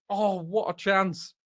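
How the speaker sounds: high, drawn-out groan of disbelief; slow, high-pitched dismay at a wasted chance; lamenting a miss. Real, not acted. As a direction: stretch it out, pained and high.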